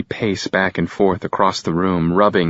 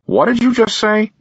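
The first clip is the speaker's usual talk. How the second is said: father's voice, higher and a little louder; unfriendly father; 4 dB louder than usual. Acted. Father confronting his son, unfriendly. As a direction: demand an answer, cold and hostile. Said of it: "tone that's none too friendly".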